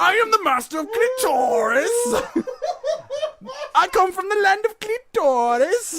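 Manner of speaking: high loud laughing hero voice; mock grand proclamation. Joking about the coworker, mock grand hero voice.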